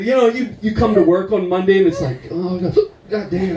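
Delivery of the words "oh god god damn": hungover groan. Acted. Hungover at work Monday.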